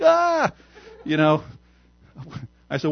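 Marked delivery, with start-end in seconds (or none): 0.0-0.5 mock alarmed yelp. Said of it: "She's like".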